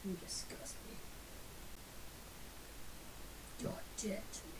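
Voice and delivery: dramatic whisper. Pretend. Mock disowning after betrayal.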